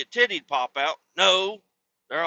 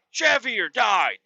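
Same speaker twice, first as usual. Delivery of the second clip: shout; 7 semitones higher than usual. Retold or imitated. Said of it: "back then I was like".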